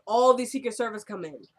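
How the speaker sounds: usual speaking voice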